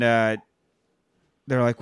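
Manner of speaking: usual speaking voice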